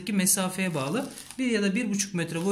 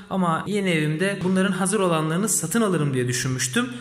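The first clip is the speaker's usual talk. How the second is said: usual speaking voice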